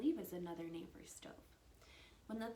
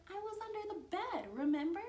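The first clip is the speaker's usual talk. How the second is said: put-on childlike protest; 12 semitones higher, 5 dB louder than usual.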